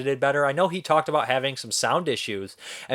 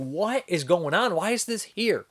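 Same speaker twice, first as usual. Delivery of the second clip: higher, slow, incredulous questions; exasperated bafflement; 7 semitones higher, slower than usual. Retold or imitated. Viewer questioning the bizarre sound mix, baffled and high-pitched. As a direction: act out bewildered disbelief, pitched up.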